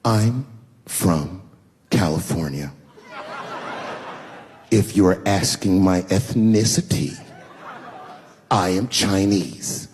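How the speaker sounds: slow, low, over-separated words; very condescending. Acted. Offended woman answering where she's from, condescending. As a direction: spell it out word by word, talking down. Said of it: "She goes, very condescendingly".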